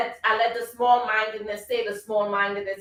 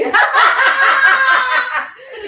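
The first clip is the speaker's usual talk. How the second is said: loud laugh; 11 dB louder than usual. Real, not acted. Laughs after her impression.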